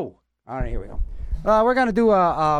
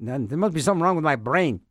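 normal talking voice

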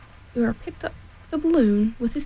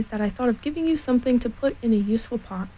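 ordinary delivery, nothing marked

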